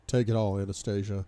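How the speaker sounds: ordinary delivery, nothing marked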